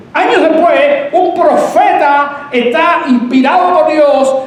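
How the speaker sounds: high, loud, slow proclamation; high-pitched pulpit shout; full-force preaching. Real, not acted. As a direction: preach it out loudly, stretching the words.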